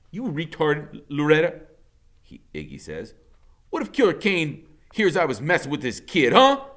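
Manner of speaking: loud, scornful; scolding. A narrator's reading. Iggy scolding Loretta, loud and scornful. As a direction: talk sense into her.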